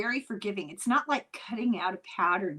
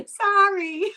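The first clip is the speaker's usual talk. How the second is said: ordinary talk, nothing marked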